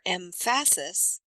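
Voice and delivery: "emphasis" stressed wrongly on the second syllable, em-FA-sis; misplaced syllable stress. Shown as a demonstration. Coach demonstrating an accent error, "emphasis" with stress on FA. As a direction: say "emphasis" slowly, punching the middle syllable. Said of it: "on the wrong syllable".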